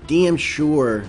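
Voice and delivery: ordinary delivery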